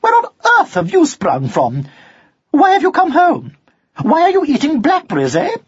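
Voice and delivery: high, quick-fire questioning; sharp scolding. A narrator's reading. Mother scolds son for coming home, sharply. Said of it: "she said sharply".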